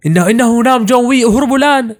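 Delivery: put-on high-pitched frightened child; stammering, alarmed kid fleeing; panicked warning.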